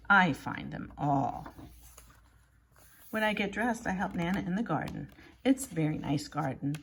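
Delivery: usual speaking voice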